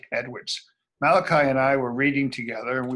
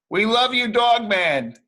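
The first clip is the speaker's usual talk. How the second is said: bright high cheer for the hero; cheering crowd; 6 semitones higher, 5 dB louder than usual. Acted. Kid voicing townsfolk, a cheer for Dogman. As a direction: call out your love to the hero.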